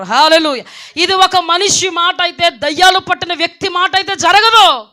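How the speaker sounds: loud, high preaching voice; full-force preaching. Acted. Testifying to the congregation, loud fervent preaching. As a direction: proclaim it to the whole church.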